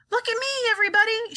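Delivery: grandma crowing high while flying; shouting. A narrator's reading. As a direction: call out for everyone to watch. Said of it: "shouted Grandma Josephine".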